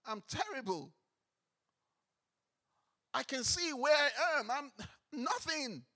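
a stunned man feeling small before God; Isaiah paraphrased, awed and overwhelmed; awestruck Isaiah. Retold or imitated.

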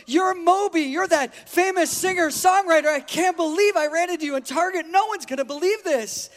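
imitated starstruck outburst, high and loud; excited fan. Woman tells the man she thinks he's [name], excited. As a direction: gush like an overwhelmed fan. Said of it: "jumping up and down and hyperventilating".

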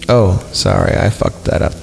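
usual speaking voice

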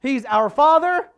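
loud, slow mock-sermon proclamation; emphatic preaching. Preacher re-enacting his mistaken sermon, grandly emphatic. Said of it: "giving them the business".

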